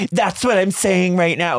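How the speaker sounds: exaggerated high, drawn-out mimicry; [name] mocking Shannon. [name] parroting Shannon back at her, high mocking imitation. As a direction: throw the words back in a slow, high mocking voice. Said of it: "Like, she imitates Shannon".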